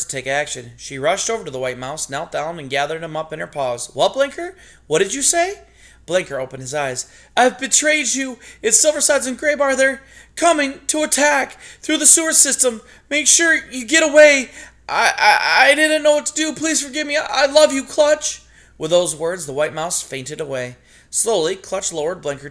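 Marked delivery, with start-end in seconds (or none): 3.9-5.6 high, urgent question; startled and anxious; alarmed. Acted. Clutch alarmed by collapsed friend. 7.4-18.4 high, loud, desperate pleading; anguished confession. Acted. Dying mouse confesses to Clutch.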